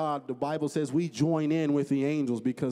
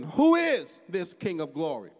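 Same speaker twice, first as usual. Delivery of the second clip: slow, loud, raised pulpit question; rhetorical question; 5 semitones higher, 8 dB louder than usual. Acted. Preacher quoting David's question to the congregation, rhetorical and declaimed. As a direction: declaim the question slowly, higher and louder. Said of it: "he asks a rhetorical question".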